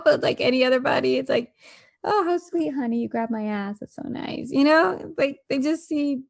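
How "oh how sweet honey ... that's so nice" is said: wife imitated, pleased at being grabbed, sugary and fading to a whisper; mock sweetness. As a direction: gush over it like a flattered sweetheart. Same act, imitated as pleased.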